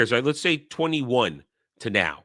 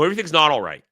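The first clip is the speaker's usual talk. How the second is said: usual speaking voice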